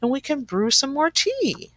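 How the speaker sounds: high soothing squeaky mouse; mouse squeaks. Acted. Mouse reassuring the sad bear, high squeak. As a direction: comfort him in a tiny high voice. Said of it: "Mouse squeaks".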